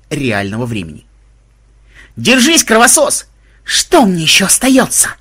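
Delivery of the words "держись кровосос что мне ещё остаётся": loud, high, pinned-down howl. A narrator's reading. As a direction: yell it out under strain. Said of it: "взвыл Ксенобайт".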